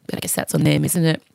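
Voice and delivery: ordinary delivery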